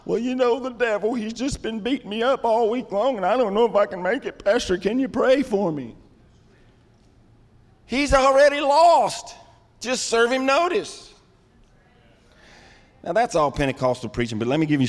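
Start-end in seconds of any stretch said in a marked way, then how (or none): 0.1-6.0 higher, mock-weary complaint; lightly voiced moping believer asking for prayer; whiny defeated churchgoer. 7.9-11.1 preaching. Real, not acted. Preaching at full force.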